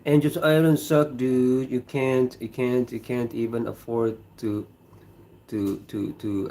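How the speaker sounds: ordinary talk, nothing marked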